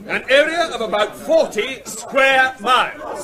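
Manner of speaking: loud, pushing against the noise. Acted. Official pressing on through a rowdy briefing, loud. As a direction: project over the crowd. Speaks over rising uproar.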